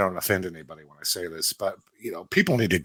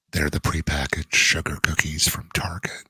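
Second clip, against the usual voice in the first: hushed, husky whisper; sultry phone sex voice; 2 semitones lower than usual. Pretend. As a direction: purr it like a nineties hotline operator. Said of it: "okay, let me do my voice".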